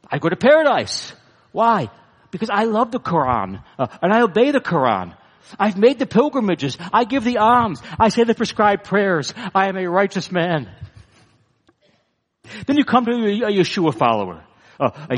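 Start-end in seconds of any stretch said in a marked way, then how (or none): 0.1-10.7 higher, confident, self-satisfied; proud self-righteous answer. Acted. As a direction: boast of your own deeds, a little lifted.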